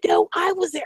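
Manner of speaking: high-pitched squealed claim; excited insistence. Retold or imitated. Retold girls trading claims about concert, excited. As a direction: imitate a girl insisting in delight.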